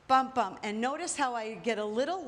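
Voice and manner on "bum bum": "bum, bum" punched out louder, falling; punchy count. Shown as a demonstration. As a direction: call the beats "bum, bum" like hits of a drum. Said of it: "like this pelvis shimmy".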